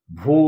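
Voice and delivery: ভূ said alone, then a pause; syllable on its own. Shown as a demonstration. Teacher shows where speech can stop, ভূ as a separate syllable. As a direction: stop cleanly after ভূ. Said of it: "বলে থামতে পারছে".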